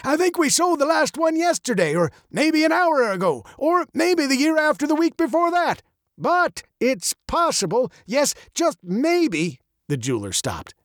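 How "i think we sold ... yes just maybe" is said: put-on high, gravelly old man's voice. A narrator's reading. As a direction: muse like a muddled old man, high and gravelly. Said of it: "his voice as old and gravelly as his face".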